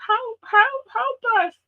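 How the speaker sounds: mock a helpless city crying for help, high; high put-on voice begging for help; mock pleading.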